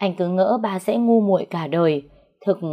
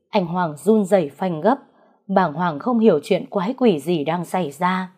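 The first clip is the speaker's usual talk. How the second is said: plain reading voice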